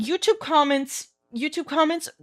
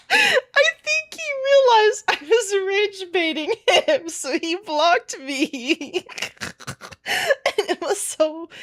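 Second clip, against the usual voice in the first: high, laughing, then slow; breathless laughing retelling; 7 semitones higher than usual. Real, not acted. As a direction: giggle through the punchline. Laughing at being blocked.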